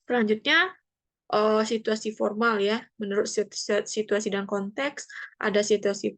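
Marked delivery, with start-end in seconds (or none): none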